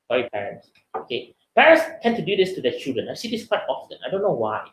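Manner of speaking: ordinary delivery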